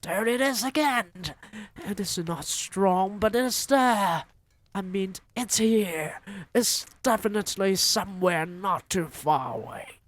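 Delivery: high, loud witch shout. Acted. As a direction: shout the find excitedly. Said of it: "shouted the first witch".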